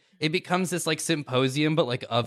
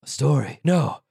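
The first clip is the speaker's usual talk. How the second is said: alarmed Prillik voice; 4 semitones higher, 4 dB louder than usual. Acted. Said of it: "someone goes".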